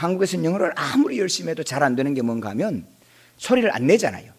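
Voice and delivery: ordinary talk, nothing marked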